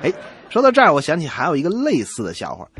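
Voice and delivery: normal talking voice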